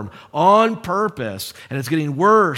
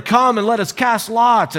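high raised call to the others; yelling back and forth; 6 semitones higher, 4 dB louder than usual. Acted. Sailors to one another in the storm, yelling. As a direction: shout it across to your mates. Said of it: "you hear them yelling back and forth".

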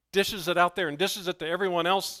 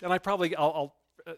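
ordinary delivery

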